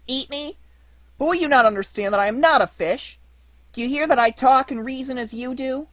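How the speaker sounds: high, loud, pleading puppet voice; panicked little puppet arguing for his life; alarmed puppet. Acted. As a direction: beg him to see reason.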